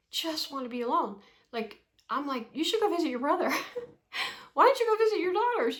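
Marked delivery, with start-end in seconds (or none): 2.5-5.8 higher and louder, pushing him out the door; rising and quicker on "Why don't you go visit your daughters?"; urging husband. Retold or imitated. Urging husband to leave her alone.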